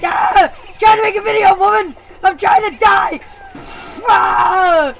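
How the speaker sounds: loud, high frustrated yells at the noise; angry yelling. Acted. Gamer shouting at a screaming woman, loud and angry. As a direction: yell over the racket in fury.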